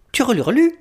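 high chirpy budgie trill; sing-song bird twitter; birdsong trill. Acted.

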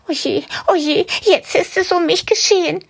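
very high, panicked rabbit thought; a rabbit sure he's doomed; despairing rabbit. Acted. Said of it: "denkt es". (hohe, verzweifelte Kaninchenstimme)

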